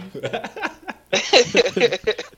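high laugh. Real, not acted. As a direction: giggle at the joke. Teasing about the wife.

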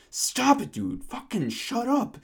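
high, slow, drawn-out retold protest; mock outraged kid. Kid scolding the old man on the bus, mock outrage. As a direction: imitate a kid calling someone out, high and indignant. Kid's retold outburst at racist remark.

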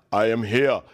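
a hint of a stately entrance; mock grand announcement. Jesus arriving, in a lightly mocked solemn voice. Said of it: "he was like".

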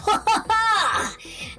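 high booming villain laugh; villain's distant laughter. Acted. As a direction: throw out a long, high evil laugh. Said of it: "You can hear his laughing in the distance".

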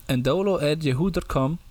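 usual speaking voice

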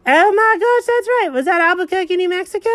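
high, thrilled "oh my gosh"; light up with the discovery; excited realization. Real, not acted. Owner suddenly thrilled about Albuquerque, high voice.

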